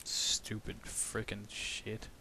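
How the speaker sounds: whispered under the breath; frustrated whisper. Real, not acted. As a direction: mutter it to yourself in whisper. Muttered frustration.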